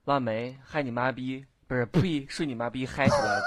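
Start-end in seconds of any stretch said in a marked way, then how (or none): 2.9-3.4 sudden much higher shout to pump up; hype shout. Real, not acted. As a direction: whoop it up to wake the room. Hype shout mid-rant. (突然高声喊嗨起来)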